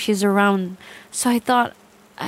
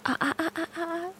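ordinary delivery

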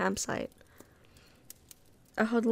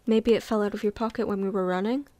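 usual speaking voice

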